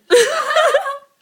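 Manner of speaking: loud shrieking laugh; high burst of laughter. Real, not acted. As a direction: squeal with laughter. Laughing after drunk voice.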